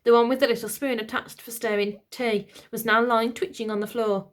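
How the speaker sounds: ordinary delivery, nothing marked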